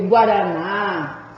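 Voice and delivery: "guaraná" with a long held "ná"; stress on final syllable. Shown as a demonstration. Teacher giving oxytone examples, slow stress on "ná" in "guaraná". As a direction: draw out the last syllable of "guaraná". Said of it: "sílaba tônica está na última".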